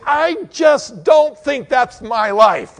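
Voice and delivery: loud, high, slow protest; objecting shout. Acted. Preacher voicing a doubting listener, loud protest.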